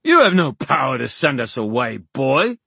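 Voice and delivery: high, loud haughty snap; snapped. A narrator's reading. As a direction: snap it contemptuously at an inferior. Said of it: "snapped Oban".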